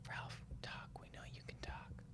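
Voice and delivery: coaxing whisper to the dog. Real, not acted. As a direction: whisper softly to get him talking.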